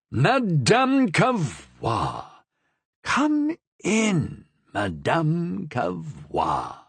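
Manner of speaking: pleasant call. A narrator's reading. The Cardinal cheerfully calls Madame Cavrois in, pleasant and slow. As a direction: call her in sweetly, stretching each name. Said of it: "in his most pleasant voice that he called out".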